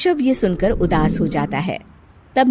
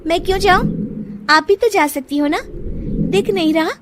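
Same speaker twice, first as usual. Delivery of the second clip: high, defiant argument; arguing bride; 10 semitones higher than usual. Acted. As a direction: talk back defiantly. Bride argues back.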